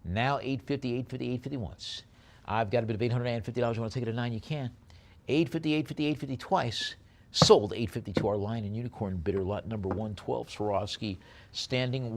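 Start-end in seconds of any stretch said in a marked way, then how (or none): 0.0-10.5 fast, punchy auctioneer patter; auction bid calling. Acted. Auctioneer to online bidders, brisk bid calling. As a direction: rattle off the bids and knock it down.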